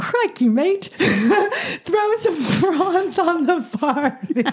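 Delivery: laughing attempt at an Australian accent, high and slow; breaking into laughter mid bad Aussie accent. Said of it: "give us your best Aussie accent".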